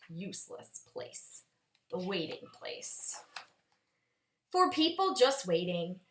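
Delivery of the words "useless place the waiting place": hushed whisper on the place name. A narrator's reading. Narrator hushing the child about the place, whispered. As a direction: breathe it like a secret.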